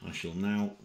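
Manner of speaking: usual speaking voice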